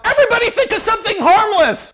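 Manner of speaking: high, fast, loud command; urgent yell. Acted. Becker yelling to the team in the ghost storm, fast high shout. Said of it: "Becker yells out".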